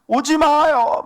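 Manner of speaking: high, acted plea to keep away; Jehoshaphat crying out. Preacher playing a son in danger as enemies come, crying out. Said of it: "아들이 소리를 지르면".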